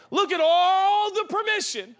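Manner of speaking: all stretched high and loud; stress on all. Real, not acted. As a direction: belt out a long, amazed all. Marvels emphatically at God's permission.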